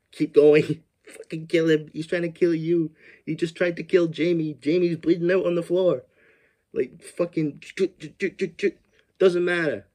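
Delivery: high, loud exasperated shouting; real outrage at the screen; frustrated yelling. Real, not acted. Viewer yelling at the heroine to stab him, real loud frustration.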